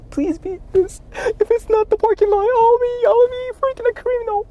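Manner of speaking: high, whiny near-crying plea; begging with a breaking voice; high crying plea, scared about the parking; desperate pleading. Real, not acted.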